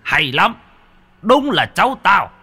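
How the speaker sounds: beam with pride at him; proud praise. Acted. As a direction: grandpa praising grandson, laughing. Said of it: "thì cười vui vẻ".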